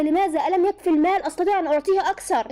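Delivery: high, loud startled questioning. A narrator's reading. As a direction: blurt it out in shocked disbelief. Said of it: "سالته بخضه".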